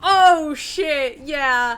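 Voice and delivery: startled loud oh shit; shocked outburst at the damage; surprised. Real, not acted.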